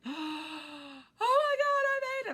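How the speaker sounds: very high, loud burst of relief; relieved cry. Retold or imitated. Safe at the bottom of the hill, crying out in relief.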